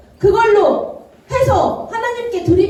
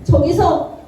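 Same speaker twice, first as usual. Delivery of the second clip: ordinary talk, nothing marked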